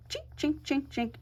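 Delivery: high, soft, rhythmic snipping sound; imitated scissor snips.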